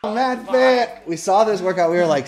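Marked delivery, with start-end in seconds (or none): 0.0-1.1 exasperated shout. Real, not acted. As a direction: shout it out, beaten by the workout. Mid-workout, cursing the instructor.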